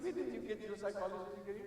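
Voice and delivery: teasing wife. Retold or imitated. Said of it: "I said".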